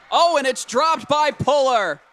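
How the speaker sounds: loud, high burst of surprise; excited play-by-play call. Real, not acted. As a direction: call the sudden error at full voice. Live call of dropped catch.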